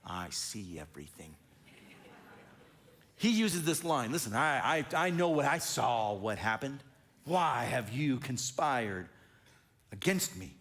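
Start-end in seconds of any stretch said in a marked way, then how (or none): 0.0-1.3 much lower and softer, mock-ominous "I see everything"; spooky parent line. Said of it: "I tell my kids when they say". 7.2-10.5 slow, much lower, menacing demand; accusing king. Acted. King Saul accusing the priest.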